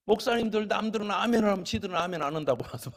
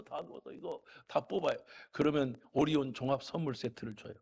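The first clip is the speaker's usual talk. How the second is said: ordinary talk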